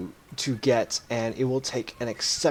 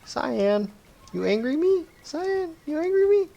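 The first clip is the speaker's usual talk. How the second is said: each "Cyan, you angry me?" climbs much higher; rising pestering; 10 semitones higher than usual. Acted. Gau teasing Cyan, pitch climbing higher each time. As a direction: pester him, going up and up.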